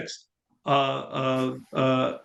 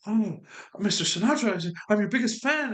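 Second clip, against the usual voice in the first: eager, higher-pitched starstruck fan; gushing fan; 5 semitones higher than usual. Retold or imitated. Teenage fan gushing over [name] at the diner, excited.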